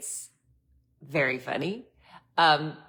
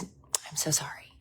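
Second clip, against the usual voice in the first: whispered apology; 7 dB quieter, slower than usual. Retold or imitated. Whispered quote of the rep.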